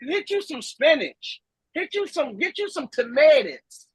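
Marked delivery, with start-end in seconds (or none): none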